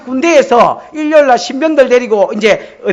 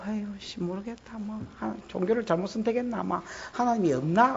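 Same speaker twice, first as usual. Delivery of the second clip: much softer, giving-up mumble; muttering despair; 14 dB quieter than usual. Acted. 낙심한 신자가 혼잣말로, 훨씬 작게 중얼거리듯. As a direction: sigh it out like someone ready to quit.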